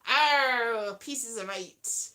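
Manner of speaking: low, rolling pirate growl; mostly soft, low West Country pirate. Acted. Pirate telling newcomers about free ports, low pirate voice. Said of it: "That's a pirate voice, isn't it?".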